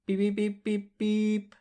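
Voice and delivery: high-pitched Morse code beeps; high beeping imitation. Shown as a demonstration. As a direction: beep it out like a telegraph. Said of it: "where we're gonna go beep".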